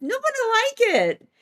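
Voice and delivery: very high little voice; gleeful troublemaker. Retold or imitated. Unseen prankster answers its companion, high playful voice. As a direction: imitate the culprit defending their fun. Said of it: "And he goes".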